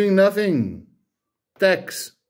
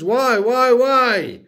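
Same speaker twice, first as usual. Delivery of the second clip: raised, repeated angry why; exasperated demand; 5 semitones higher, 3 dB louder, slower than usual. Real, not acted. Taxpayer demanding answers from government, exasperated and raised. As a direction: hammer the question louder each time.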